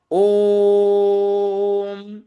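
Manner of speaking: ओम held very long and loud on one steady note; loud sustained ओम; prolated three-beat vowel. Shown as a demonstration. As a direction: chant ओम, stretching the vowel into a closing hum. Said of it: "प्लुत इसका उच्चारण त्रिमात्रिक होना चाहिए".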